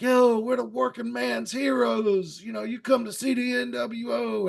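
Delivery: Scott Hall. Retold or imitated. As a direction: call it out like a wrestler working the crowd. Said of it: "Scott Hall's like".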